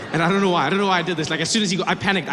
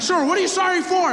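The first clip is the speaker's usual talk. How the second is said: much higher cop voice; a jittery officer asking; startled cop; 11 semitones higher, 3 dB louder than usual. Retold or imitated. Policeman voice.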